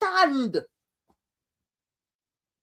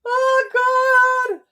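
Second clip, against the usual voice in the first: high, louder cry of exasperation through laughter; exasperated laugh; 13 semitones higher, 4 dB louder than usual. Real, not acted. Vlogger laughing at the statement in exasperation. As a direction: laugh it out in disbelief. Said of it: "I don't want to laugh".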